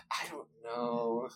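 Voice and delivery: slow, soft imitation of dithering; hushed, drawn-out mock uncertainty; mock indecision. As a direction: drag it out quietly, as if stumped. Imitating girlfriend unable to decide.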